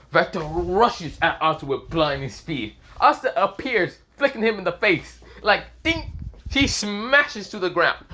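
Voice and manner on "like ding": ding sound effect. Retold or imitated. Imitating a flick sound.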